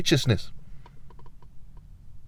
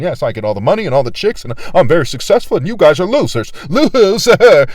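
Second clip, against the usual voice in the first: building from mock boast to loud sneering taunt; mocking a smug winner; 2 semitones higher, 5 dB louder than usual. Mocking the cowards he imagines gloating at him, sneering. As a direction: ham up a gloating coward calling others losers. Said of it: "bootlicking cowards going".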